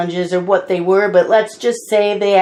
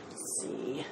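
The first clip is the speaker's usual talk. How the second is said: whisper; 10 semitones lower, 24 dB quieter than usual. Real, not acted. Muttering to herself mid-video.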